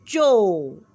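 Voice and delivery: loud stretched "चो"; demo sound. Shown as a demonstration. Teacher showing children the ओ ending. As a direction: say "चो" slowly and loudly for the class. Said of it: "मैं जब बोलती हूँ चो".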